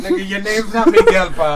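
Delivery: mock loud callout to a phone agent; calling him out. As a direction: bust the fake name loudly. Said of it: "I'm like".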